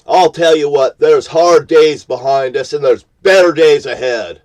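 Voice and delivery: loud, high, raging yell; real anger boiling over into a shout; furious shouting. Real, not acted. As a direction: bellow it at the whole country. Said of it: "because I am pissed".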